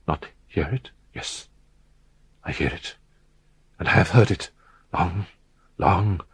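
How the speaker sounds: barely voiced dread, as if talking to himself; low gibbering murmur. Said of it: "he spoke in a low, hurried, and gibbering murmur".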